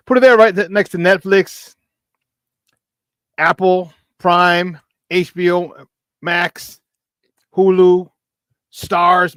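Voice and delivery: pumped promo shout-out; hyped-up listing. Real, not acted. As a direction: sell it like a hype man. Hyping streaming apps to viewers.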